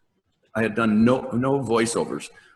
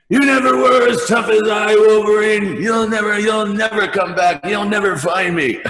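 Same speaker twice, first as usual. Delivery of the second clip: high, loud, gloating taunt shouted across the ice; Sabretooth yelling; 12 semitones higher, 6 dB louder than usual. Retold or imitated. Sabretooth yells down at Wolverine in the water, gloating. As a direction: bellow it like a villain who won't shut up. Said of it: "Sabretooth is still yelling".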